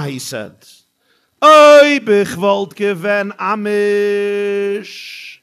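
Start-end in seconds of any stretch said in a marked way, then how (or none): 1.4-5.4 high loud cry, then slow held chant; Yiddish lament. Acted. Man answering the woman in Yiddish, mock lament. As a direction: wail it out in Yiddish, stretching the last word. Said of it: "his turn to respond in Yiddish".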